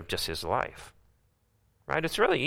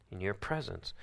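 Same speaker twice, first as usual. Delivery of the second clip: softer still, YOUR stressed; soft echo; 12 dB quieter than usual. Shown as a demonstration. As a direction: barely voice it.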